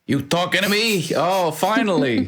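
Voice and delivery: a hint of a streetwise accent, glad to be noticed; Bruno's accent. Shopkeeper Bruno greets the player, in a light tough-guy accent. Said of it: "a character built, you know, with this accent built in".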